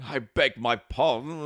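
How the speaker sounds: slow, plummy posh gent; lightly voiced posh man. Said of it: "He did have a really posh voice".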